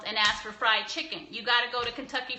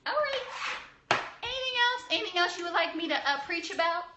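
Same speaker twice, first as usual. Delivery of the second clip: much higher, sing-song asking the chat; bright teasing call for more questions; preachy mood; 8 semitones higher than usual. Real, not acted. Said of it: "I'm in a preachy mood".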